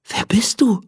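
whispered, wary question; hushed and cautious; timid whisper. Acted. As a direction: whisper into the chest, unsure what's inside. Lilly asks the rumbling chest.

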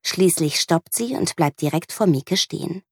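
ordinary delivery

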